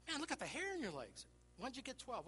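high, excited disbelief; hammed-up astonishment; astonished. Retold astonishment at the boy.

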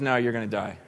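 low, matter-of-fact delivery; blunt verdict. Retold or imitated. Micaiah answering the king who pressed him, low and blunt. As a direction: drop the voice for the blunt truth.

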